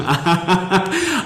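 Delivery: a high, real laugh that falls away; burst of laughter. Real, not acted. Clerk laughs at being asked about betraying his mark's trust, a high falling laugh. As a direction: laugh out loud at the question, then let it drop.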